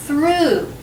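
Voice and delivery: "through" ending in a long ooh, gh silent; ooh sound. Shown as a demonstration. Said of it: "can also be pronounced".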